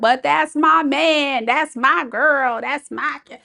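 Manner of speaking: high, slow mock whine; mock protesting. Host imitating viewers who defend their partners, mock protest. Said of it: "will hear this and be like".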